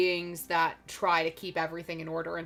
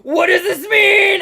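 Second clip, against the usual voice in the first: loud, high-pitched panicked cry; freaking out; 11 semitones higher, 15 dB louder, slower than usual. Retold or imitated. Panicked World Walkers to the fortune teller, freaking out. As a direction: shout it slowly in a mock panic. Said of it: "they're, like, freaking out".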